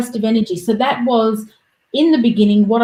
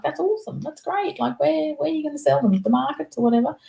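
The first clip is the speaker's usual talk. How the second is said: excited dad voice; 6 semitones higher, 5 dB quieter than usual. Retold or imitated. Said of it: "and he'd be like".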